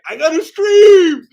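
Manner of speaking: high, drawn-out, very loud outburst; frantic mock yell. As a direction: yell it like a panicked streamer, hammed up. Imagines frantic man searching at home.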